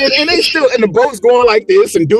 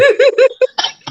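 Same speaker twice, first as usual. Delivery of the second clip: short high laugh. Real, not acted. Laughing at friends' invite.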